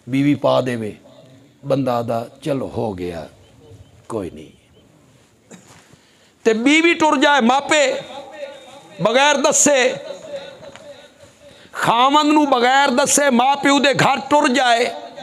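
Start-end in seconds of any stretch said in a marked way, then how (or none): 2.4-4.5 low, soft, letting it go; resigned acceptance. Acted. Forgiving husband's shrugged reaction, acted. 6.4-15.1 high, sustained preacher's cry; raised, ringing pulpit delivery; impassioned preaching. Real, not acted. Preaches at full force.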